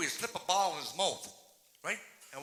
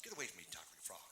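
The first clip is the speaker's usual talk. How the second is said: low, quick, softer brush-off; acted father grumbling a child away; dismissive father; 10 semitones lower, 15 dB quieter, faster than usual. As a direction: wave the child off, low and fast. Annoyed father brushes off daughter.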